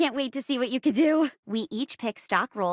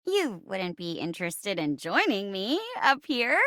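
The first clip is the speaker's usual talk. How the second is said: ordinary delivery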